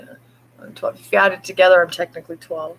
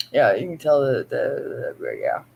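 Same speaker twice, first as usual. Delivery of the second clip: ordinary talk, nothing marked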